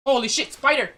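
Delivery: startled, high, loud shout; screaming at a spider. Real, not acted. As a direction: yell in real fright. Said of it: "that was so scary".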